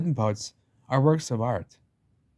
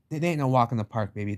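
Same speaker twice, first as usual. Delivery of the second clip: ordinary delivery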